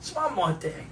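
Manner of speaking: very soft detective mumble; Columbo. Acted. Showing how easy the voice is, quiet impression. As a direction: murmur the catchphrase in character. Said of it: "Columbo's a fun voice to do".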